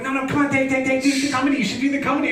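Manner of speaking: high-pitched, quick mock nagging; insistent pestering friend. Friend pushing him to do comedy, insistently pestering. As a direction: keep badgering, words tumbling out high.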